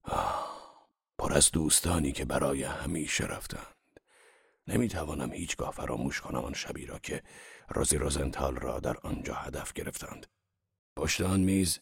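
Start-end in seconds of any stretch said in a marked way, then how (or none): 0.0-0.9 wistful sigh. Acted. Sigh in sad memory. 1.2-10.3 put-on mildly sad, softer old man remembering; a touch of mourning for lost friends; sadly. Wolfshiem mourning dead friends at the Metropole, softly sad. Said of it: "غمگنانه گفت".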